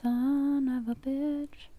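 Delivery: very slow, stretched-out exasperation; slow drawn-out curse. Real, not acted. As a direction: drag each word out in defeat.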